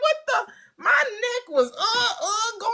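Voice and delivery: high, startled squeal, laughing through it; mock ride panic. Retelling the Iron Wolf ride, high mock alarm. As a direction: squeal like the ride is yanking your neck. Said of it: "I was like".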